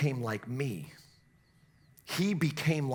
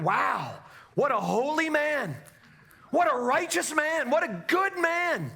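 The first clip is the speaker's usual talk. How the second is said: mock admiring neighbour; 11 semitones higher, 4 dB louder than usual. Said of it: "You may impress your neighbor".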